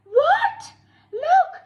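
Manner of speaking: high, loud, half-laughing alarm; panicked laughing cry. Acted. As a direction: blurt it out in nervous panic. Character alarmed, laughing-crying.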